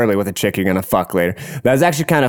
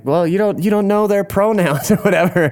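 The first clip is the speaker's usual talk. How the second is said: higher-pitched mock reproach; imitated sister scolding; 10 semitones higher than usual. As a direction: imitate an incredulous sister correcting you. Sister voiced scolding him.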